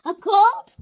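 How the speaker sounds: loud, high disbelief; startled question; surprised. Acted. Gerald surprised.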